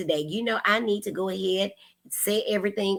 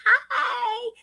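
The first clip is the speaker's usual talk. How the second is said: high delighted hi. Real, not acted. Greeting a viewer waving.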